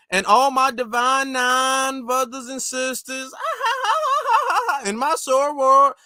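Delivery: mocking put-on Southern accent, high and drawn out, with a laugh; Harris's fake Southern accent. Host mimicking a politician's speech to sorority sisters, mock Southern accent. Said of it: "she does not talk like that".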